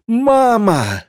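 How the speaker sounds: high, long wail for mama; very high childish scream; child's piercing cry. A narrator's reading. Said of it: "Детский крик резанул по ушам".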